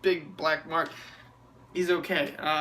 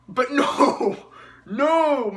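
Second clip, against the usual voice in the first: higher and louder gleeful no; excited exclamation; 8 semitones higher, 6 dB louder, much slower than usual. Real, not acted. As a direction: burst out at the twist. Sudden rescue twist.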